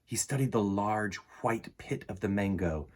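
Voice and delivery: put-on storybook narration for kids; reading to children. A narrator's reading. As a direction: read the story aloud to the class. Reads picture book to children.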